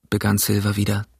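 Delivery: usual speaking voice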